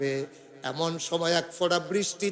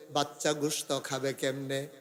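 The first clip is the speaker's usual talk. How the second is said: ordinary delivery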